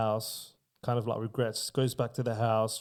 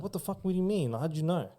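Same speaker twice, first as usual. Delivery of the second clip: higher, frightened blurting, half acted; retold scared disbelief; 6 semitones higher, much faster than usual. Spooked son to his mother, higher, retold panic. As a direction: imitate a spooked guy caught out by his mum.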